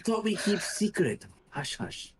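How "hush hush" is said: hushed, breathy shushing; whisper. Acted. Shushing the party in a whisper. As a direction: whisper it to keep the group quiet. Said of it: "he's attempting to whisper".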